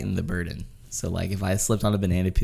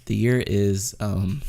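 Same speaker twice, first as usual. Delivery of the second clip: usual speaking voice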